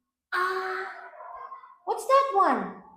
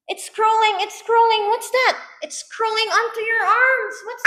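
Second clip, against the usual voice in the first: playful pretend fright, higher; mock alarm; 5 semitones higher, 4 dB louder than usual. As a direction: gasp and act scared for a toddler. Pretend ant scare for child.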